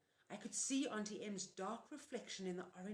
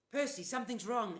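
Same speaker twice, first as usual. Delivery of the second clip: urgent, louder insistence; insisting; 4 semitones higher, 9 dB louder than usual. A narrator's reading. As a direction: press him to listen now. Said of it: "Annabeth insisted".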